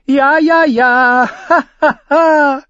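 high cackling giggle; jester's laugh. Acted. Fool cackling behind Davos, high giggling laugh.

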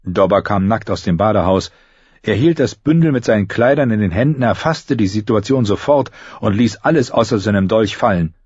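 ordinary delivery

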